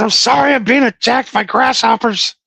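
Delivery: high, hammed-up panic; drama queen. Driver explaining himself to the drive-thru guy, drama-queen panic. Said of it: "I'm a drama queen".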